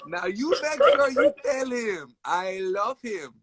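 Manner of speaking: high-pitched Panamanian godmother, stretched-out words; imitated Panamanian godmother, very high and drawn out; Panamanian accent. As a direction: exclaim in a high Caribbean-tinged Panamanian accent, then insist slowly. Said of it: "What accent is that? ... that was a Panamanian accent?".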